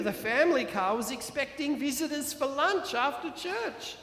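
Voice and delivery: ordinary delivery, nothing marked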